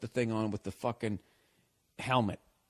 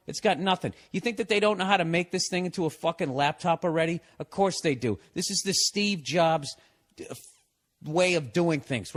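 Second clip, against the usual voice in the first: worked-up, higher-pitched comic rant; temper tantrum; 4 semitones higher, 3 dB louder than usual. Real, not acted. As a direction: rail indignantly against the gadget. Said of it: "I'm throwing a temper tantrum here".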